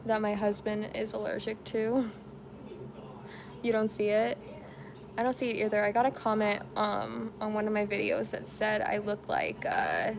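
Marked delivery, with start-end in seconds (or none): none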